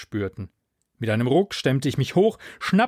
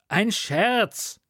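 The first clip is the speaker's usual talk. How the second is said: calling out; 9 semitones higher than usual. A narrator's reading. Friend protesting after his prank went wrong, calling out. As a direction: exclaim it in a raised pitch. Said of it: "rief Iwan".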